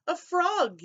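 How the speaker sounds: high yelled retort; a boy yelling his answer. A narrator's reading. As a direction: yell back at him. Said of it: "yelled the other".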